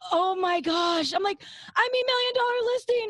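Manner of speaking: high, loud, panicked blurt; very high embarrassed backpedal; flustered yelled correction. Retold or imitated. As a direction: shout the correction, mortified. Blurting correction after wrong show yelled.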